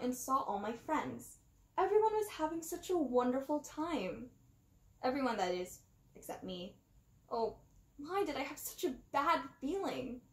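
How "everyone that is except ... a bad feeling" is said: ordinary delivery, nothing marked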